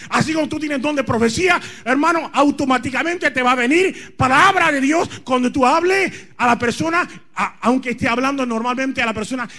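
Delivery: shouted, fiery sermon; preaching at full force. Acted.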